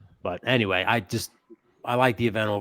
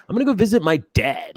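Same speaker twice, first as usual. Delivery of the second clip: much higher, louder whiny line; mock bratty heel; 11 semitones higher, 6 dB louder than usual. Host mocks Dominic Mysterio taunting his dad. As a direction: whine it like a bratty son. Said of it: "was like".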